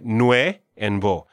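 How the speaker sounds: "Nue and vo", each beat slow and separate; two syllables. Shown as a demonstration. Teacher breaking nuevo down, slow separate "Nue and vo". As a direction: pull the word apart as nweh, vo. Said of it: "is a two-syllable".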